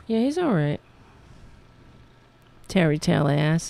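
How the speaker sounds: normal talking voice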